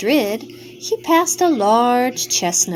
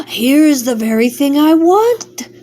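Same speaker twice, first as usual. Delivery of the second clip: loud, eager cry of delight; excited exclamation; 4 semitones higher, 8 dB louder than usual. A narrator's reading. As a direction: cook spots his dinner, loud and excited. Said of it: "the cook exclaimed".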